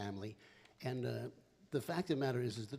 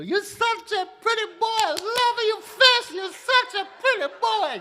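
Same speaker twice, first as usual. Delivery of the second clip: high, loud doting Italian grandma voice; Italian grandmother; 16 dB louder, slower than usual. Retold or imitated. Grandma gushing over her grandson, high and loud. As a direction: squeal it like a grandma pinching cheeks. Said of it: "she always used to grab my little face and go".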